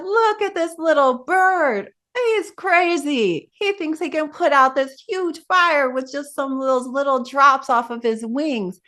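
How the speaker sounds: high, mocking laugh-at-him voice; lightly voiced jeering deva; mocking gods. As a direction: scoff at the foolish little bird from above. Gods laughing at the little bird.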